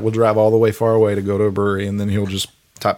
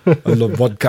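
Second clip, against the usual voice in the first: a light mock Russian accent on I love vodka; a touch of a Russian accent; 3 dB louder than usual. Shown as a demonstration. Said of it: "You need to say that in a Russian voice".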